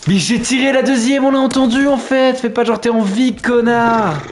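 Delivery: high, loud hyped announcer; radio host yell. Acted. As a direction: belt it like a hype radio DJ. Radio DJ style yelling.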